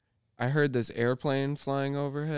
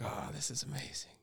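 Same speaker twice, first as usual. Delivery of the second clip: hushed, breathless whisper of wonder; awed whisper; 12 dB quieter than usual. Retold or imitated. Tripper feeling mushrooms come up, whispering in awe.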